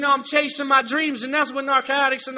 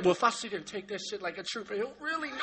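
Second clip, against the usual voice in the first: quick, much softer mock voice; mock inner voice; 5 semitones lower, 14 dB quieter, faster than usual. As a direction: rattle it off under your breath like a tough guy. Mocking men's inner voice.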